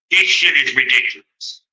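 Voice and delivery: usual speaking voice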